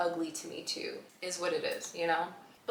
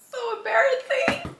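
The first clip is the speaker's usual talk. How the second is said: very high embarrassed squeak; mock mortified; 3 dB louder than usual. Everyone learns she is crying, high mortified squeal.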